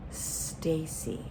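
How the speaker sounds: soft, measured calling of a name; voice of the Spirit. Retold or imitated. The Spirit calling her by name, soft imitated voice. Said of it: "the Spirit spoke to me".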